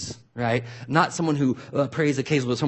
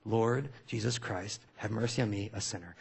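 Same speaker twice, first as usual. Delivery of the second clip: softer, slower devout chant fading out; hushed repeated prayer; 3 semitones lower, 7 dB quieter than usual. Shown as a demonstration. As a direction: murmur the prayer over and over, letting it fade. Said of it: "They do it again".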